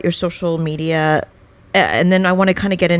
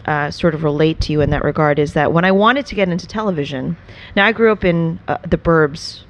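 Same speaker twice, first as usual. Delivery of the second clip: ordinary talk, nothing marked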